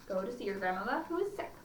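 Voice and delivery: ordinary delivery, nothing marked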